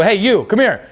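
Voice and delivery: high, bright call across the room. Retold or imitated.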